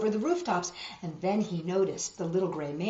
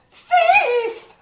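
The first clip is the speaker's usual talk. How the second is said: much higher, loud shouted cry; cry of thief; 13 dB louder than usual. A narrator's reading. Crowd crying out after pockets were picked. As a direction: yell it across the market. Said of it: "cries of".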